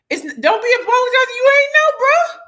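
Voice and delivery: high, loud, fast playful scold; high brassy don't-apologize; sassy reassurance. Real, not acted. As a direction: wave off the apology with sass.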